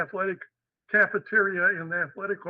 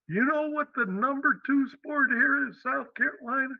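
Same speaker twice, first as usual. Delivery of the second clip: higher-pitched mock Southern accent; 7 semitones higher than usual. Athletic director quizzes the new coach, Southern accent. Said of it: "in a real Southern accent".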